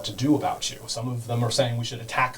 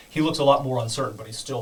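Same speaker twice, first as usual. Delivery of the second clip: ordinary delivery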